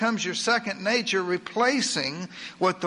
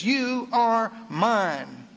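very slow, tender words of God; lightly voiced God, said slowly and gently; solemn Scripture reading; 3 semitones higher, much slower than usual. Voicing God's word.

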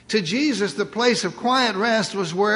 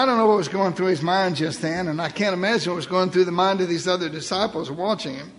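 ordinary talk, nothing marked

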